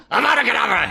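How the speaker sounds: "Abracadabra" rattled off in one quick high burst; high, rapid-fire "Abracadabra"; real fast. Acted. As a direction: gabble the word "Abracadabra" at speed. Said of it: "he says real fast".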